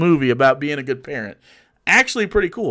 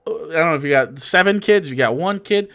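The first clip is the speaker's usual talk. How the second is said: ordinary delivery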